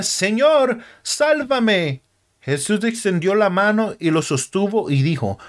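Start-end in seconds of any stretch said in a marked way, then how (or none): 0.0-2.0 mild cry out. A narrator's reading. Sinking Peter cries to Jesus for rescue, mild raised cry. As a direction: call for help with restrained urgency. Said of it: "Pedro comenzó a gritar".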